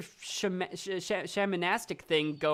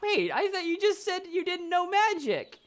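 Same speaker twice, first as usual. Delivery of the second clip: very high, alarmed objection; startled protest; 12 semitones higher than usual. Acted. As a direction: blurt out a high-pitched protest. Character protests in acted argument.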